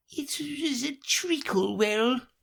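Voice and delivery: high, tiny rodent voice; hurried Dormouse. Acted. Dormouse hurrying to start its story. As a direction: rush to start the tale.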